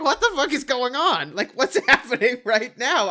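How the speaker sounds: high, loud, laughing disbelief. Retold or imitated. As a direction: shout it in stunned laughter. Audience reaction to finale.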